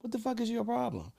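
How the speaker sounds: angry high confrontation. Retold or imitated. Man confronting the mother on the phone, outraged high voice. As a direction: confront her, voice jumping high.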